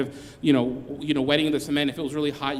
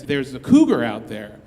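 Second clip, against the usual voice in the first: urgent warning; 8 dB louder than usual. Retold or imitated. Cleaning guy warns after slamming door.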